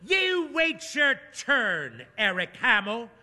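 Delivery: put-on high, slow old lady snap; snapping. A narrator's reading. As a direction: scold the young man sharply. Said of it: "Mabel snaps".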